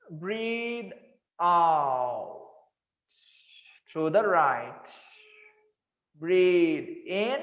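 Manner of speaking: slow, calm breathing instructor; guided breathing. Acted. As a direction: draw each cue out slowly and calmly.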